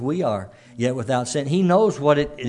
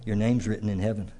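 softer, gentle reassurance; calm, soothing Lord; 4 semitones lower, 8 dB quieter than usual. Pretend. Preacher voicing the Lord calming a troubled listener, softly reassuring. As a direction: soothe the anxious soul quietly. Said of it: "the Lord's just saying".

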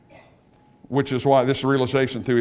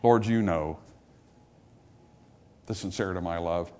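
low, soft sincerity, fading at the end; humble confession; 9 semitones lower, 8 dB quieter than usual. Retold or imitated. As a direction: admit it quietly, without any claim. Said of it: "looks at the Lord and says".

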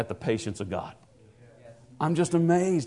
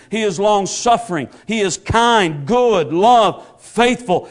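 rising, louder preacher's litany; preaching at full force; 4 semitones higher, 4 dB louder than usual. Acted. Preacher building to the congregation, rising and loud. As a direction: build the list of praises up.

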